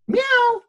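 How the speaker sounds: very high, thin meow; cat meow. Retold or imitated. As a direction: mew like a small stray cat. Imitating a stray cat.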